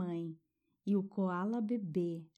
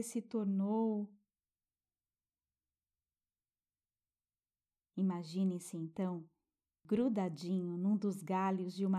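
guided meditation. Acted. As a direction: soothe a child toward sleep.

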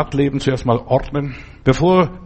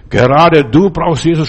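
loud retold yell, pitched up; shouted at him; 3 semitones higher, 6 dB louder than usual. Porsche driver yells at the preacher through the window, loud shout. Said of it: "schreit er mir zu".

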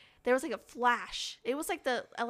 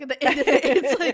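mock spooky build-up; 3 semitones higher, 15 dB louder than usual. Building up the scary drain story.

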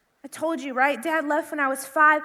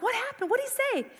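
curious friends; 7 semitones higher, 6 dB quieter than usual. Retold or imitated. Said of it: "They're like".

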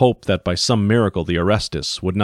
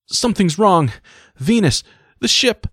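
much higher, louder panicked cry; sudden panic; 13 semitones higher, 4 dB louder than usual. Acted. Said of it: "Sudden panic".

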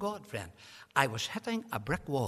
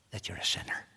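whisper; 8 semitones lower than usual. Real, not acted. Sermon climax, dropped to a hush.

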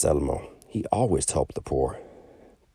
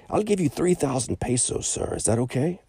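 much higher, eager dealer's voice; eager moneylender; 10 semitones higher than usual. Acted. Moneylender offers the merchant three thousand pesos, eager. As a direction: offer the price brightly.

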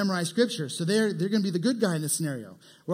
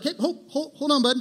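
interrupting; 8 semitones higher than usual. Retold or imitated. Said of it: "You just kinda wanna be like".

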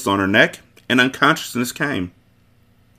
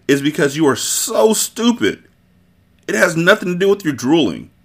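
contemptuous; 7 semitones higher than usual. Acted. Sicilian mocks the Turk.

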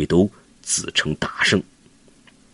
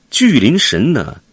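usual speaking voice

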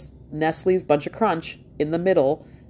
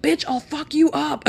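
higher, sassy fake threat; mock threat; 11 semitones higher than usual. Answering the doctor's advice to do yoga, higher mock threat. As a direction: snap back in comic outrage. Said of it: "And it's like".

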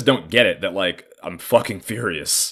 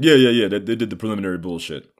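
imitating dad; 2 semitones higher, 5 dB louder, faster than usual. Dad brushing off the question.

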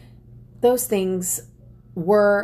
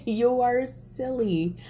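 light scoffing laugh, then higher, drawn-out words; amused dismissal; 6 semitones higher, 3 dB quieter, slower than usual. Retold or imitated. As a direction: laugh it off, then slow and pitched up. Scoffing thought quoted in retelling.

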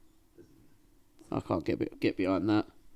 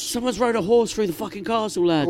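mock alarmed soldier; 9 semitones higher than usual. Mocking the film's castle guards.

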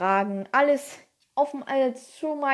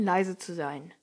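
plain reading voice

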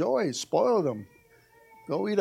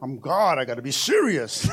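mock serious God; 6 semitones lower than usual. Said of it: "God is not all serious up there like".

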